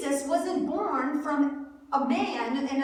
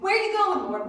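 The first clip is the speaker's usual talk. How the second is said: loud, higher, impatient interruption; blurted out; 4 semitones higher, 4 dB louder, faster than usual. Retold or imitated. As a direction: burst in with the question, imitating an eager disciple. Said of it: "He blurts out".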